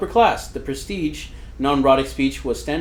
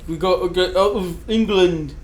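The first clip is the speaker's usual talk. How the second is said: higher-pitched put-on British accent; mock British accent; 5 semitones higher than usual. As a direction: play an old English settler, British accent. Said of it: "How did we lose that accent?".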